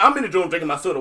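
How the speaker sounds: ordinary talk, nothing marked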